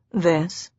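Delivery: lowish, settled statement; a quiet certainty in a man's voice; husband's simple answer. Acted. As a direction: answer her evenly, falling. Husband's answer, then echoed.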